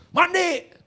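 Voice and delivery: high, sharp mock yell; snappish father. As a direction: imitate a dad's curt, high-pitched order. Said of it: "Dia kalau ngomong nyolot begitu".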